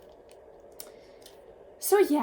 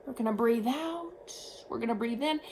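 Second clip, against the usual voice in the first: calm doctor; 5 dB quieter than usual. Retold or imitated. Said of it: "he was like".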